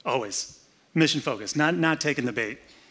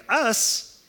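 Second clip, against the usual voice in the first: much higher startled "Us?"; shocked; 9 semitones higher than usual. Acted. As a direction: recoil at the very idea. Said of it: "came as a shock".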